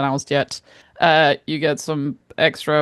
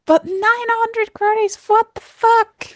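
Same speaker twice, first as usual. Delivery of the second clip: high-pitched outraged squeal; shocked, squeaky outrage at a pricey game; outraged disbelief; slower than usual. Real, not acted.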